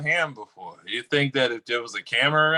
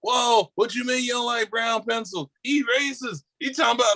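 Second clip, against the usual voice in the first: mock outraged shout; 9 semitones higher than usual. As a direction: yell the accusation way up high. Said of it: "anything you say".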